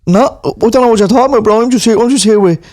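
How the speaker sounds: put-on lairy young lad in tracksuit; lad at a gaff. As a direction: call out to the boys, cocky. Same lad figure.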